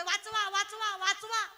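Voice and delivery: high calls for help; panicked high cries; cry for help. Acted. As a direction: call out for rescue again. Man in bear suit yelling.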